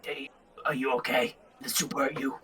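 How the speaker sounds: very soft, confidential check-in; hushed aside to a possible victim; concerned whisper. Acted. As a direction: ask it under your breath, concerned. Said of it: "she whispers to you".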